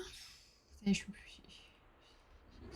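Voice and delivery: soft breathy aside; quiet resigned whisper. Real, not acted. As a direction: whisper it to yourself. Whispered in game.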